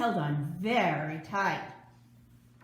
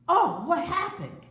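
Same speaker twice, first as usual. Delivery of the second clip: startled cry; 9 semitones higher, 7 dB louder than usual. A narrator's reading. George falls into the sea.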